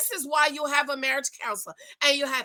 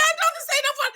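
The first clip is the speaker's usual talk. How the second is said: much higher, squeaky mocking voice; 14 semitones higher, much faster than usual. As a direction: mimic him in a high whiny pitch. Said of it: "And I'm like".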